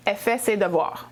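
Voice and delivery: 'elle' said as a bare 'è' with no L; Quebec contracted elle. Shown as a demonstration. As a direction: cut the L so 'elle' is just 'è'. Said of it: "we cut the L's out".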